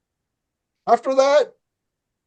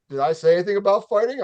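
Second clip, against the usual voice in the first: fast retort; 3 semitones higher, much faster than usual. Retold or imitated. Retort to neighbor.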